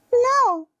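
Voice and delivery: put-on very high-pitched child refusing; high, small kid's voice saying no; squeaky little-kid refusal. Child answering therapist in role-play.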